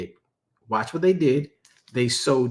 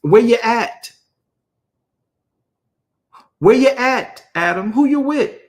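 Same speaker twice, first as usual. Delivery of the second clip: much higher and louder, a calling shout; God shouting; 9 semitones higher, 7 dB louder than usual. Acted. God shouts for the hiding Adam, high loud calling. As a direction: bellow it across the garden.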